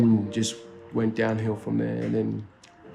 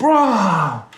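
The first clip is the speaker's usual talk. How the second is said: higher, louder drawn-out protest; appalled disbelief; 7 semitones higher, 5 dB louder than usual. Retold or imitated. Reacting to unfiltered workmate's remark, high loud mock outrage. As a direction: re-enact being scandalised, pitched up.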